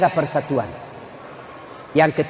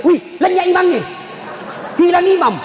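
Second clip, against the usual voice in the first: high, startled mock yell; retold shout; 13 semitones higher than usual. Preacher imitates friend finding the imam gone, shouting. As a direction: cry out as if imitating an alarmed boy. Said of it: "Langsung teriak".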